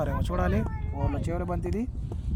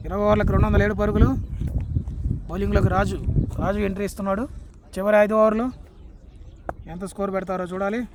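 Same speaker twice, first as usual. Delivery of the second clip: lively live play-by-play; cricket commentary; 3 semitones higher than usual. Real, not acted. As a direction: call the match as it happens.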